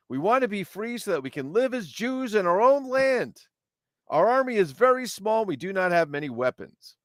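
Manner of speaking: high, declamatory rebel commander; rally the troops in a grand storybook voice; hammed-up heroic recruiting speech; Judah the Maccabee.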